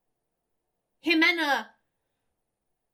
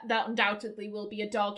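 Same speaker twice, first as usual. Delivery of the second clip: usual speaking voice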